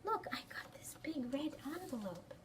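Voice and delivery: whispered, hushed showing-off; secretive whisper. Acted. Child showing her friend a red envelope, whispered.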